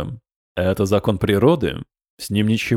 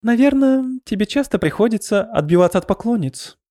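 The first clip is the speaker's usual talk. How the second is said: mock sympathy; 10 semitones higher than usual. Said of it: "сочувственно сказала Канерва".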